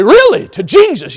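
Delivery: high, loud outraged shout; can't believe it, shout it up high; preacher voices the congregation's outrage; shocked disbelief. Retold or imitated.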